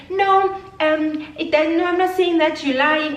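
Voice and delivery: high, whiny mock denial; high-pitched put-on objection; mimicked friend. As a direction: imitate her protesting up high. Friend's denial voiced in quarrel.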